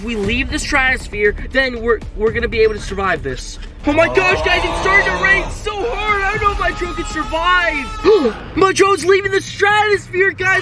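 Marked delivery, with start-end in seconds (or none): 3.9-10.6 high-pitched, breathless-with-drama commentary; excited alarm. Acted. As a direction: shout the drone's peril to viewers. Drone in rain, leaving the stratosphere.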